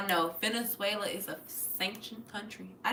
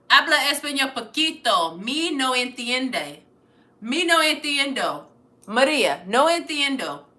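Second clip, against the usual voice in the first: calling out loud in halting Spanish; loud beginner Spanish, said to a viewer named Maria; loud broken Spanish; 2 semitones higher, 8 dB louder than usual. Real, not acted. Shouting broken Spanish at chat.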